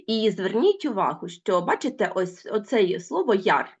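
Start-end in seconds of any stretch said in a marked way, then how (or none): none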